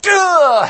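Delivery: very high, loud mock scream; tweaked to eleven. Preacher imitates someone mid-fight with partner, exploding. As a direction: explode in exasperated noise. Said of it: "you're like tweaked to eleven at that point".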